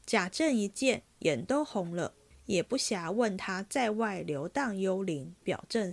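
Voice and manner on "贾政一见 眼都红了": ordinary delivery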